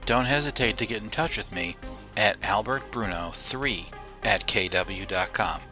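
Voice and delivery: usual speaking voice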